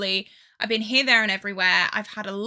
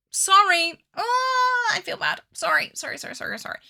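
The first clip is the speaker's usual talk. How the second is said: squeaky, mock-guilty sorries; playful apology; 9 semitones higher than usual. Real, not acted. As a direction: apologize cutely again and again. Apologizing for cutting the palette.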